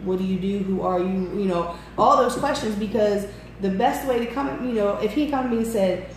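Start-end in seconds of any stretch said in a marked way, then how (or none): none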